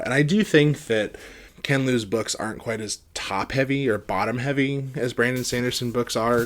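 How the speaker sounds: usual speaking voice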